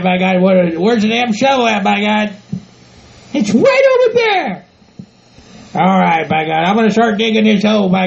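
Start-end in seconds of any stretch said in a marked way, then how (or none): 3.3-4.7 high falsetto scolding voice; bossy partner. Acted. Bride telling groom to bury the manure, bossy. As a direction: lay down the law in a high put-on voice.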